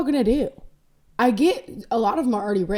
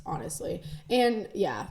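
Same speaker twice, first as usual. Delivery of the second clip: normal talking voice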